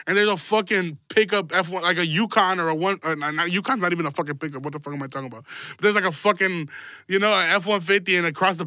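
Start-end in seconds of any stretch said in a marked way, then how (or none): none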